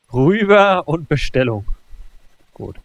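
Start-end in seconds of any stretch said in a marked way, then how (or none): 0.1-1.6 said louder and more firmly the second time; louder repeat. Real, not acted. Gamer repeating his order to himself, louder. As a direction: push the order through with emphasis.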